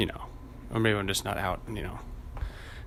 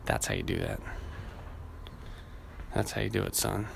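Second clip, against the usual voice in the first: ordinary talk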